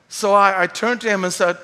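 ordinary talk

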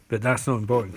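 usual speaking voice

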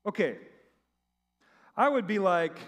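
ordinary talk, nothing marked

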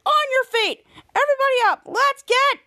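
high-pitched rallying call; rousing coach. Retold or imitated. Henry getting kids on their feet, rousing call. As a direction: get the whole team up and chanting.

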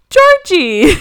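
high, loud cartoon call; mimicked calling out. Friend mimics sisters calling Peppa's brother, loud call. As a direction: cry the name out high and loud.

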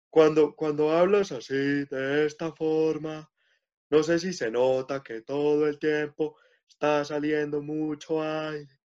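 [name]'s airy voice, demoed for the interviewer. Shown as a demonstration. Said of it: "es una voz muy airosa".

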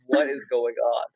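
normal talking voice

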